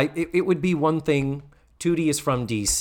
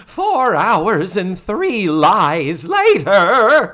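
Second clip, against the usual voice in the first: high, loud, drawn-out warble; Missus Garrett impression; 7 semitones higher, 9 dB louder, much slower than usual. Recapper as Missus Garrett scolding Miko, hammed-up outraged warble. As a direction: belt it out very slowly in mock outrage. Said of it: "Is that your Missus Garrett impression?".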